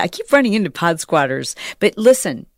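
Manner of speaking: usual speaking voice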